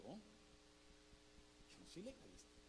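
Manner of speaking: tiny, slow near-whisper; hushed protest. Retold or imitated. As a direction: deny it very softly, as if caught out by God. Stunned reply to God's rebuke. (protesta en voz bajita, casi susurrada)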